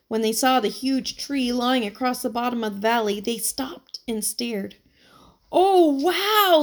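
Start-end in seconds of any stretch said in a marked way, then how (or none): 5.5-6.6 high and loud amazed gasp; awe. A narrator's reading. Girl finally sees the fallen tree, high loud awe. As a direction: struck by the sight. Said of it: "said Emily, finally".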